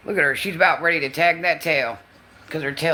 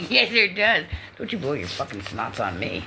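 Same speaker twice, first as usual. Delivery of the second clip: ordinary talk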